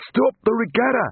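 high, panicked shout; breathless warning yelled high; panting alarm. A narrator's reading. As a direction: cry out urgently, short of breath. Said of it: "panted one of the coxes".

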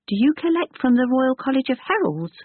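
put-on high curious boy; eager boy asking; young boy voice. Boy asks.

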